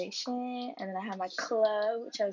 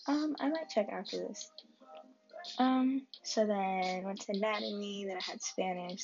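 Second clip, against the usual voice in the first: usual speaking voice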